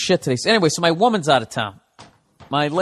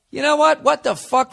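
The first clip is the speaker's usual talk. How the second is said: ordinary talk, nothing marked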